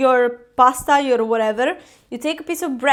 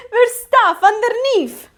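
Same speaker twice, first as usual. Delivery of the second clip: slow, laughing, heavy Italian accent; a laughing over-the-top Italian accent; strong Italian accent; 8 semitones higher, 6 dB louder, slower than usual. Real, not acted. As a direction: lean into a thick Italian accent, higher and slower. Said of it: "Why did I say it with this super strong accent?".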